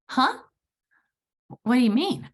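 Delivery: high, loud, startled huh; jolted into confusion, voice leaping up; stunned disbelief at a vague answer; baffled. Retold or imitated. Baffled by his one-word answer.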